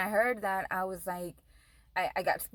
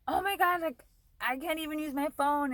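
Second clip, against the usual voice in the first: much higher, frantic cry about a dead phone; retold panic; 7 semitones higher than usual. On the phone to her boyfriend, a frantic high-pitched wail. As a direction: squeal it in a panic.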